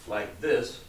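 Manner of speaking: ordinary talk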